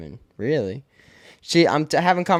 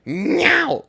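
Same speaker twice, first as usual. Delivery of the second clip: angry meow; 5 dB louder than usual. Retold or imitated. Cat meowing angrily at owner.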